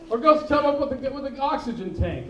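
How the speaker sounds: ordinary talk, nothing marked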